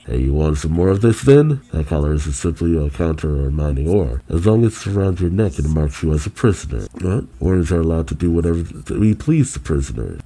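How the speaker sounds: ordinary talk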